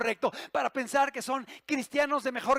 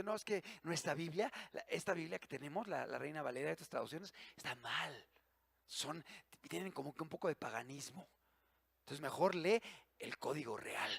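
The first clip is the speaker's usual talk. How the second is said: quiet mocking purist; 9 semitones lower, 15 dB quieter than usual. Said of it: "Porque dice".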